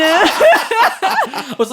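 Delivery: laughter taking over the line; laughing hard. Real, not acted. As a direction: gasp the words out between laughs. Laughing hard at being teased.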